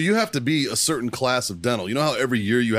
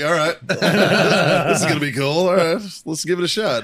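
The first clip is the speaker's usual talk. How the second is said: laughing; 5 semitones higher, 4 dB louder than usual. Retold or imitated. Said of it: "I'm just like".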